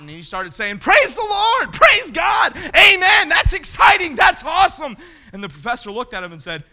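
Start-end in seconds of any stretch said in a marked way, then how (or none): 0.8-5.0 loud and high praise shouts; imitating a young man erupting in joy; excited shouting. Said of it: "the young man started shouting".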